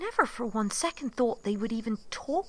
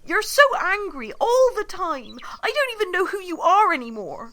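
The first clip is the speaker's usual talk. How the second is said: louder and higher, speeding up at the end; heated; 5 semitones higher, 8 dB louder than usual. Acted. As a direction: let the hurt spill out loudly. Nina quarrels with Constantine.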